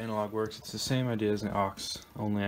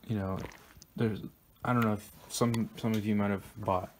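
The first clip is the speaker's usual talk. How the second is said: usual speaking voice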